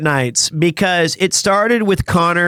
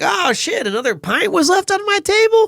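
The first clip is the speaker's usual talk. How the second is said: hammed-up surprise, much higher; mock surprise. Vlogger retells pretending shock at another pint, hammed-up surprise. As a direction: play up fake shock at the pint.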